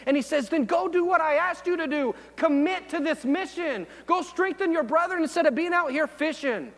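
sermon at full force; full-force preaching. Acted. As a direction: preach it like a challenge. Preaches Jesus questioning Peter.

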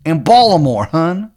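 put-on high, terrible Maryland accent; Shithead Steve's Maryland accent. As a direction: proclaim it in a hammed-up Maryland twang. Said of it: "he's got, like, a terrible Maryland accent".

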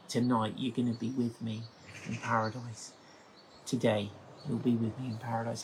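tender promise to the repentant criminal. Acted. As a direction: reassure a dying man softly. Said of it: "incredible moment of tenderness".

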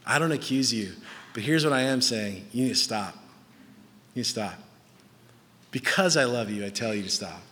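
low, soft, gentle plea; loving correction. Acted. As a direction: correct someone tenderly, voice dropped. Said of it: "that type of motivation and love".

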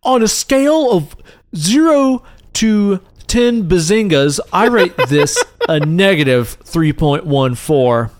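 slow, deliberate mock-official review readout; drawn-out, mock-serious listener review; imagined reviewer. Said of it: "It says, like".

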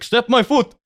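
high hammed-up Russian accent; Russian accent, high and comic; mock Russian demand. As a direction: squeak out the Russian man's demand. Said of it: "He's like".

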